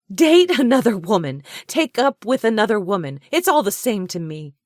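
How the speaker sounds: high, loud scornful retort; furious. A narrator's reading. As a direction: throw his words back at him. Furious woman dismisses his distinction.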